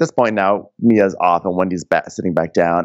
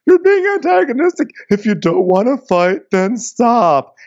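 much higher, put-on voice scolding; scolding housewife; 12 semitones higher than usual. Robin telling Wendy to stop, high put-on scolding. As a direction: lecture her in a high prissy voice.

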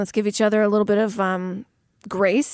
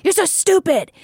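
self-scolding; 7 semitones higher than usual. Retold or imitated. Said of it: "I would yell at myself in my brain".

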